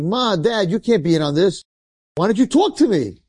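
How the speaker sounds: high, rising plea; pleading. Acted. Son begs his parents to answer him, high pleading. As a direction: plead with them in disbelief.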